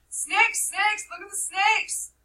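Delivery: very high, loud panicked scream; a little boy screams at the others; terrified scream. Acted. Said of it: "The little boy screamed at them".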